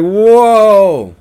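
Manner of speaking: loud, high, drawn-out whoa; big retold cry of disbelief; shocked whoa. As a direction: call out a long, stunned whoa. Shocked reply to friend's complaint.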